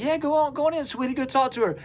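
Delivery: high, sweet motherly voice; high and sweet, a mother inviting a visitor in; sweet mother's welcome. Retold or imitated. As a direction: welcome him in like a kind mom. Mom welcoming him at the door.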